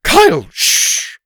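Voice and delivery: high, loud, drawn-out shush; scolding. A narrator's reading. Teacher hushing a heckler in class, loud high scolding. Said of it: "Missus Arthur scolded".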